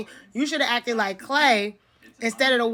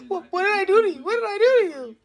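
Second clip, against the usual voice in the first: high, fake-crying plea; mock wounded whine; 10 semitones higher, faster than usual. Playing Tim's victim asking what she did, fake-crying whine.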